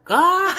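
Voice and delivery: high yelp breaking into laughter; high shriek that melts into a laugh. Acted. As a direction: cry out, then laugh it off. Reacts to spilled coffee.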